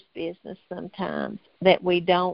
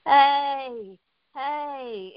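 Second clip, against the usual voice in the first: a mildly higher, hammed-up call for help; imitating a desperate cry; 6 semitones higher than usual. Prisoner calling out to Linda, mild imitated desperate cry. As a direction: echo the man's desperate cry without full force. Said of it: "he was sort of like this".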